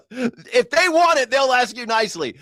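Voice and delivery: excited insistence; emphatic high exclaim. Real, not acted. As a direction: stretch it out, laughing at the idea. Laughing emphatic point.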